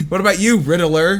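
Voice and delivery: "Riddler" stretched into three syllables, RID-uh-ler; mispronounced Riddler. Retold or imitated. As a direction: say "Riddler" with an extra uh in the middle. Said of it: "He kept trying to say Riddler".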